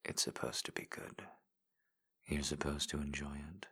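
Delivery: soft, close whispered encouragement; intimate ASMR whisper. Acted. Voice in a lover's ear, guiding in whisper. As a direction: coax them on in a hushed whisper. Erotic audio whispered guidance.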